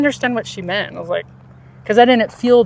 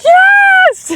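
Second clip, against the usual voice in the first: squealed, very high and loud; shrieking delighted yes; excited yes; 8 dB louder than usual. Retold or imitated. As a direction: imitate her thrilled squeal. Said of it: "I remember her getting so excited".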